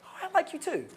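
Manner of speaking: put-on girl answering. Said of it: "the girl's gonna be like".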